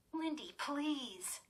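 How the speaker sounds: slow, soft plea; pleading. Acted. Girl pleading with her sister, slow and soft. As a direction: beg her sister gently. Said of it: "Chris pleaded".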